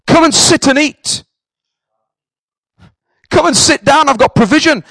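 preacher building to the altar call; preaching at full force. Acted. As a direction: lift it into a full revival preach. Preaching climax.

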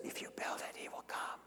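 unvoiced ghostly whisper. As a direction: breathe it like a voice in the corn. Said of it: "this voice that says".

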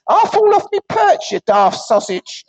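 high, squawky punchline; cheeky parrot voice. Acted. Parrot insults the vicar in the punchline, high and cheeky.

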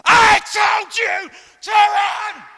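high, drawn-out yell; shouted command. Retold or imitated. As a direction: shout it slowly down the street at a runner. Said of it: "So I shouted".

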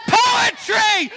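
high, loud shout of poetry. Acted. Poet shouting the word poetry to the children, loud and high. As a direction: burst out with the word. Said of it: "We can't shout poetry so much".

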